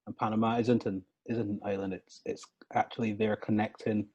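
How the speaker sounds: usual speaking voice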